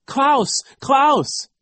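very high, loud calls of a name; desperate cry. A narrator's reading. Isadora calling to Klaus from the car, desperate cry. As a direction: call out in panic for help. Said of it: "Isadora cried".

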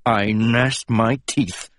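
ordinary delivery, nothing marked

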